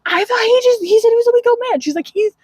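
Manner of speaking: high, lightly voiced disbelief; hamming up the upset partner; a hint of an incredulous boss; mock exasperation.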